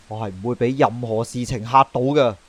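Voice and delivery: ordinary talk, nothing marked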